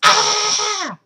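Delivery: high, loud imitated kids' scream; piercing "Ah!"; mock scream. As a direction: scream like cartoon kids running away. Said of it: "They were going".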